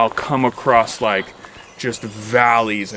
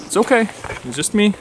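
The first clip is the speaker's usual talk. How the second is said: higher, gentle pet voice; reassuring dog; 9 semitones higher, faster than usual. Real, not acted. Hiker reassuring his dog while crouching, high and gentle.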